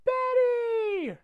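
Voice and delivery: very high, drawn-out mock call. Unseen woman calling Betty, high sing-out imitation. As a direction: call the name in a long falsetto. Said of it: "And then all you hear is".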